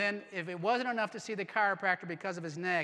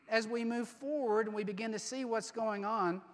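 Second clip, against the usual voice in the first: normal talking voice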